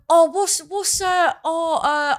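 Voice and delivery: high-pitched mock confused fan; hammed-up fumbling for the song title; clueless gig-goer. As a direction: play a dithering concertgoer, pitched high. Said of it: "And I'm gonna go".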